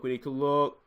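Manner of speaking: ordinary delivery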